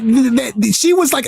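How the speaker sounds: ordinary talk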